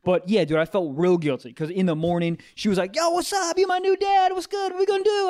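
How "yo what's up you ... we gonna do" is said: very high, eager puppy voice; squeaky-high excited dog. Acted. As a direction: greet your new dad like a hyped pup.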